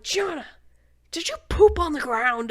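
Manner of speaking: high, loud horrified question; retold horror at poop on the floor; alarmed question. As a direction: call out to the toddler in alarm. Mom questions toddler about poop.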